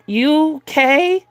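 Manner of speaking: put-on high little-kid voice with a British lilt; mock child, high and cute; British kid's voice. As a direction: squeak it out like a small British boy. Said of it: "this kid was British".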